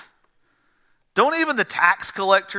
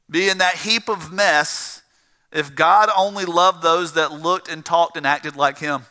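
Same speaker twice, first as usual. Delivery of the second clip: usual speaking voice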